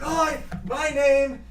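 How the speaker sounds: high, gasping, winded voice; mayor winded from running downhill; out-of-breath mayor. Acted. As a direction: pant between words after running. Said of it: "He's like".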